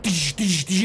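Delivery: quick hissy gunfire noises; imitated gunshots. Storyteller mimics Lori firing her gun, imitated shots. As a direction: mimic a pistol firing shot after shot. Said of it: "she just starts firing, like".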